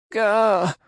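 very high, loud startled yelp; shrill holler. Acted. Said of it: "hollered in a shrill voice".

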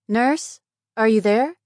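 loud, high call with a questioning lift. Acted. Patient calling the night nurse through the door, calling out.

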